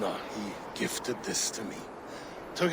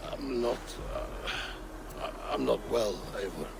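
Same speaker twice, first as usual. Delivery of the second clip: weary confession; 2 semitones lower, 6 dB quieter than usual. Acted. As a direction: falter as you admit weakness. Admits he is unwell.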